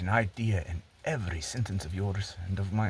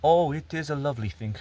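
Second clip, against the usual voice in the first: higher-pitched gushing reader; playing the public, pitched up on the compliment; admiring public; 6 semitones higher, 3 dB louder than usual. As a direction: lift the voice to mimic a reader's praise. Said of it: "the public reads it and they say".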